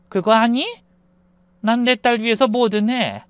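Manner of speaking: controlled taunting voice with sudden jabs; menacing mother. A narrator's reading. Mother threatening husband for her daughter's sake, menacing. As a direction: warn him with cool contempt.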